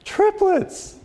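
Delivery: very high-pitched, incredulous delight; overjoyed new father. Acted. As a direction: squeal it like a comically thrilled dad. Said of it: "he's all happy and everything".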